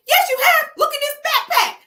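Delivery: high, loud accusing cry; indignant woman's shout. Retold or imitated. Woman shouting at the man who denies knowing her, high and loud. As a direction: throw it at him like a scorned lover.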